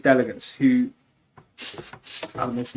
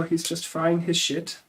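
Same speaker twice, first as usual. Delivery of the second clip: higher bad German accent, stress on "frying"; hammed-up bad German accent, lean on "frying"; imitating a friend's accent as a bad German one; 6 semitones higher, slower than usual. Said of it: "I'm gonna butcher his accent right now".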